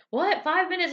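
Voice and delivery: very high mock-chef disbelief; Gordon surprised. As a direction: chef caught off guard.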